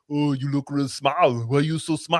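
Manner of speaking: taunting Arnold. Retold or imitated. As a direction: needle him like Arnold on the gym floor. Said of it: "Arnold in that is just unhinged, just going around like".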